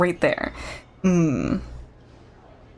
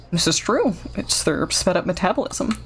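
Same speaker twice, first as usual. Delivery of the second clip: normal talking voice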